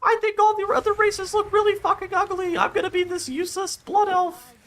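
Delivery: high, put-on snooty voice mocking a vain friend; snobby blood elf friend. Mocking a blood elf friend in a high snooty voice. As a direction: sneer it like a vain player who only cares about looks. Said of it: "friend that's a lifelong blood elf who's like".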